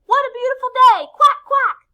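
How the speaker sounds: high, loud, fast exclamation; shouting duck. A narrator's reading. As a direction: exuberant noisy duck at full volume. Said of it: "shouts Dottie".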